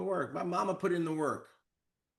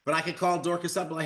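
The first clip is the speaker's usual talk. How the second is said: ordinary delivery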